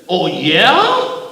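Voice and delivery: loud, high challenge cracking upward; cracking boom. A narrator's reading. Giant losing it at the driver, booming challenge. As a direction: boom it out in outrage. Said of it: "The gorilla's voice cracked and boomed".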